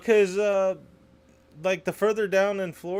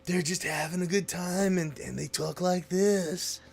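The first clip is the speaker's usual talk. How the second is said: slow, lazy southern drawl, lightly done; 2 semitones higher, 5 dB quieter, slower than usual. Retold or imitated. Mocking southern Americans to friends with a slow light drawl. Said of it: "the stereotype of other people".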